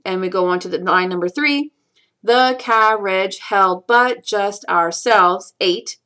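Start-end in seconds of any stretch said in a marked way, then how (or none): 2.2-5.5 slow, each syllable set apart; counting out syllables. Shown as a demonstration. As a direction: count the line out beat by beat. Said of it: "count out the syllables".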